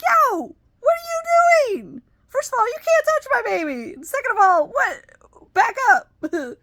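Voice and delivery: high, loud pretend confrontation; imagined telling-off. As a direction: pretend to tell off a stranger. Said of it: "I didn't even say it that way".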